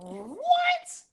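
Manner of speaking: a sharp, very high shocked "What?". Retold or imitated. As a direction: disbelief jumping up into a high squeak. She hears girlfriend answered.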